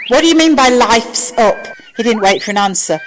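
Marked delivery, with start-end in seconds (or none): 0.1-1.7 higher, louder alarmed question; panicked. A narrator's reading. Sam demands an answer from Al, panicked. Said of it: "Sam Beckett panicked".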